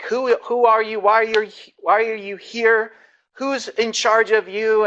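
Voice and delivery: high, loud imitation of an official shouting in broken English; retold yell in broken English. Pastor imitating the uniformed official yelling at his youth group in broken English. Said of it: "he starts screaming in broken English".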